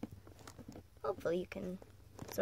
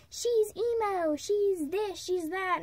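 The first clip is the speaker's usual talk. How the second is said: high-pitched mock judgment; mocking critics; 9 semitones higher, slower than usual. Voicing those who will see this and judge, mocking. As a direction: mimic naysayers in a much higher voice.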